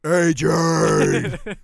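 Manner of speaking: full-force drill sergeant shout of a name; Marine Corps yell. Retold or imitated. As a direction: bellow it like a Marine from a hilltop. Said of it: "my most, uh, profound Marine Corps voice".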